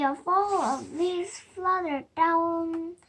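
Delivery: ordinary delivery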